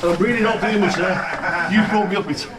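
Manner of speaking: ordinary delivery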